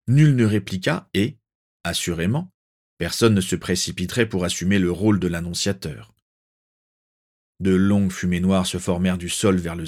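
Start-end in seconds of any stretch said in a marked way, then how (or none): none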